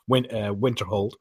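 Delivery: ordinary talk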